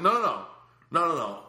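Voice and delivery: high, insistent string of refusals; re-enacting a flustered protest; acted panicked refusal. Acts out panicked refusal of ride.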